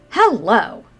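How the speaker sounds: hearty hello. Acted. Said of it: "You give him a hearty".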